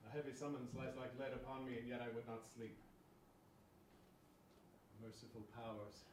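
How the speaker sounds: soft, weary musing; subdued unease. Acted. Sleepless soldier troubled by dark thoughts, subdued unease. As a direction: let it sink into a hushed prayer. Uneasy man prays at night.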